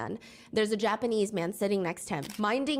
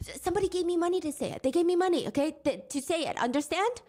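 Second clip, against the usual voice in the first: higher, fast nervous explaining; man blurting excuses to biker, higher and fast; mock frantic excuse; 7 semitones higher than usual.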